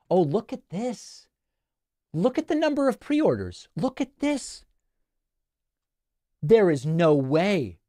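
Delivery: mock-astonished, playful reaction; surprised. Real, not acted. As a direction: gasp in delighted disbelief. Shows his book pre-order numbers.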